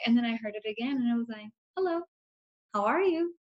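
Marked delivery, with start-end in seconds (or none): 1.8-3.3 spirit voice. Pretend. Spirit speaks again while channeling.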